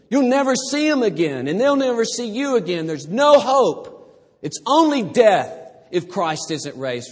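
high, loud preaching voice; impassioned preaching. Acted. Pastor preaching the resurrection to the congregation, loud and high. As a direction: drive the scripture home with force.

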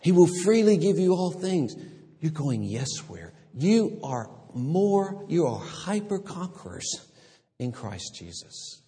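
fervent preaching. Real, not acted. Preaching climax.

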